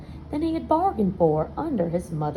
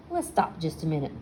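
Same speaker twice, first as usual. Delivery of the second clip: usual speaking voice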